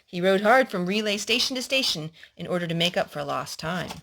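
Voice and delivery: steady storybook reading voice; reading a story to a little boy; story narration. Acted. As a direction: read it like a picture book to a child. Adult reading a story aloud.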